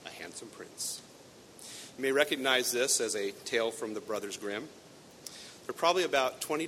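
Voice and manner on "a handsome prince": hushed, secretive reveal; whisper. Real, not acted. Preacher revealing the ending to listeners, whispered. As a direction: breathe the answer softly.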